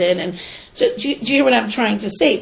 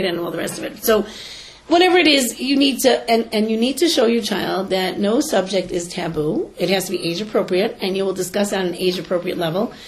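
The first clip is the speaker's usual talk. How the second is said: ordinary delivery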